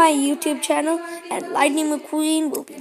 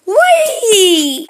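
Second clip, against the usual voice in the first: whee; 6 semitones higher, 8 dB louder than usual. Pretend. Car zooms down the ramp.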